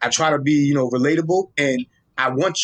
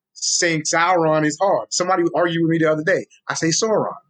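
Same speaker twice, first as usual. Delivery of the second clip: usual speaking voice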